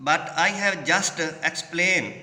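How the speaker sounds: each word drawn out slowly for the class; teacher reading a quote aloud slowly. Shown as a demonstration. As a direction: read it out slowly and clearly. Teacher reads dialogue line slowly.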